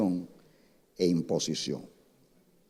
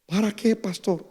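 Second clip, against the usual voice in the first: put-on little girl's question; 10 semitones higher than usual. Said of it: "little girl asks the pastor".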